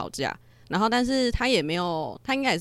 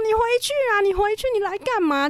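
high, loud imitation of an angry man; furious yelling; 4 dB louder than usual. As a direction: drive them away with a high-pitched yell. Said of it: "他就狂吼说".

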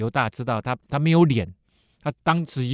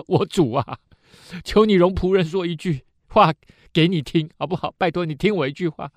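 lightly voiced Judah begging, a bit higher; pleading Judah; 6 semitones higher, 5 dB louder than usual. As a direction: beg the powerful lord, half flattering.

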